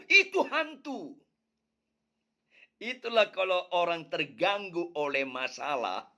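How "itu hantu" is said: high, slow startled cry of ghost; frightened cry. Acted. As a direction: gasp it out like seeing a ghost. Disciples cry out in fear.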